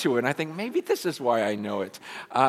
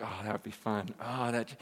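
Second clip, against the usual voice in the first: much softer, longing aside; tempted murmur; 5 semitones lower, 11 dB quieter than usual. Retold or imitated. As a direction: breathe it as if tempted. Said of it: "you think".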